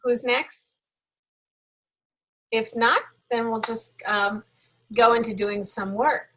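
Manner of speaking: normal talking voice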